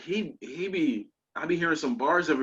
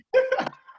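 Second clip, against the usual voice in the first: high, quick burst of laughter; brief real cackle; short laugh. Real, not acted. Host amused at claiming a rapper, laughing.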